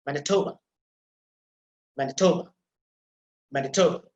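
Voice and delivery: Manitoba said alone, stress on TO, last vowel barely audible; stress shown on Manitoba. Shown as a demonstration. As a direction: say Manitoba with a strong TO and faint final schwa. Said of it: "you have to pronounce it like this".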